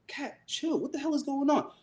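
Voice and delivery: high-pitched retold plea to chill; exasperated calming. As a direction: act out baffled pleading. Calming angry Kat, retold.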